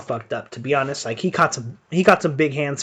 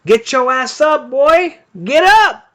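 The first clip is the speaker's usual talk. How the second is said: much higher, louder shouting at the screen; hype yelling; 9 semitones higher, 6 dB louder, slower than usual. Real, not acted. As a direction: yell at the fighter to get up. Yelling at a fight on stream.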